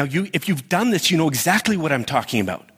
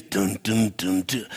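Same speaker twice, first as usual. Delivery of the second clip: low ominous dun dun, fading softer; suspense music; 6 semitones lower, 3 dB quieter, slower than usual. Retold or imitated. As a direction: hum the scary movie score low.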